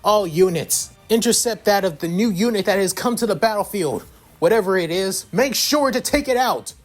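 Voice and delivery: rally the army, demand they act; commanding order. Acted.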